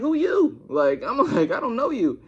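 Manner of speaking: high, loud, mock incredulous; hammed-up disbelief at a stranger; retold indignant challenge. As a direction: challenge her loudly, acting it out. Acted challenge to the girl.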